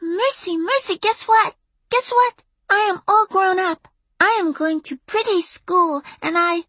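put-on very high, eager small-child voice; excited preschooler squeak; high-pitched little girl. A narrator's reading. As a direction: gush big news as a tiny kid. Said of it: "said a high-pitched voice".

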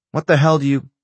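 sharp, loud, rising anger; irritable outburst. Acted. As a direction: snap back in offended disbelief. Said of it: "you're irritable".

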